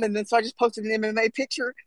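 ordinary delivery

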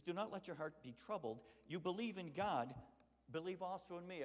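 loud, high impassioned preaching. Acted. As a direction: preach it out with full fire. Preaching Jesus' words.

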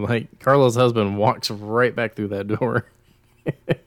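usual speaking voice

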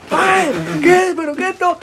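imitating his own stunned reaction; startled disbelief. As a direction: blurt it out in shock. Retelling his shock after falling.

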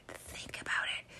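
very soft, voiceless whisper; secretive full whisper. Real, not acted. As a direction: whisper it like a forbidden thought. Repeats the taboo thought whispered.

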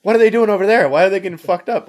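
high, loud alarmed yelling; sudden panic about their own platoon; alarmed outburst. Retold or imitated. Veteran reliving his platoon's alarm, loud and high.

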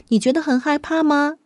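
ordinary delivery, nothing marked

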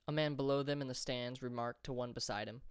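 ordinary delivery, nothing marked

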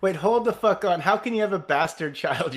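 loud, incredulous protest; surprised. Real, not acted. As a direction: stop them in disbelief. Shocked at bastard child.

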